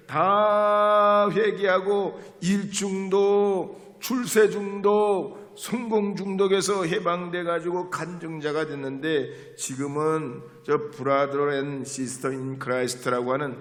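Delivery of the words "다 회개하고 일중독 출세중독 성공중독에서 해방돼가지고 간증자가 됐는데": loud, high fiery preaching; preaching at full force. Acted. Preacher telling the congregation of the wild dawn prayer, fiery preaching. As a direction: pour it out like a revival preacher.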